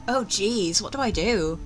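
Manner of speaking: usual speaking voice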